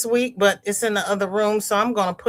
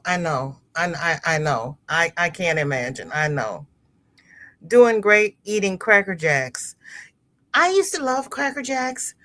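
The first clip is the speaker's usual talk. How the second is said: ordinary delivery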